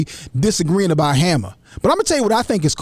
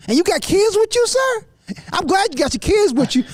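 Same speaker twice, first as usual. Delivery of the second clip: high, indignant disbelief; incredulous rant; 8 semitones higher than usual. Real, not acted. Host ranting to the panel about sagging grown men, high and incredulous. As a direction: pitch climbing with outrage at grown men.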